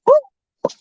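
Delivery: high squeaky whoop as the ball hits; cartoon whoop for the hit; whoop sound effect. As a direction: yelp the impact like a cartoon. Baseball smacks Johnny, sound effect.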